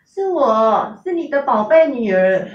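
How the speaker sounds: coy, sweet-talking. Retold or imitated. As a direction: coax her mother like a spoilt little girl. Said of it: "她很撒娇的这样跟我讲".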